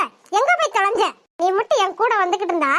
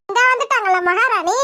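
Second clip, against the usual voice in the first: ordinary talk, nothing marked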